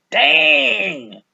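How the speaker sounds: loud, high, slowed boasting; shouting his own name with swagger; braggy rapper hype. Retold or imitated. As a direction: hype yourself up like a wannabe gangster. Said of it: "And you're like".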